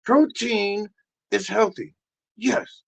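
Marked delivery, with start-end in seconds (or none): none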